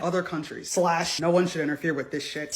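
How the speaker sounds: low, soft, sinister imitation; mock Putin voice. Paraphrasing Putin's warning, low and sinister. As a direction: growl it quietly like a menacing leader. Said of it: "when he said".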